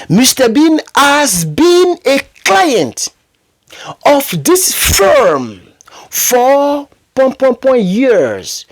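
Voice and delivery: slow, high, drawn-out dictation; slow teacher dictation voice; reading an example sentence. Shown as a demonstration. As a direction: read it out word by word for the class. Reads example sentence slowly.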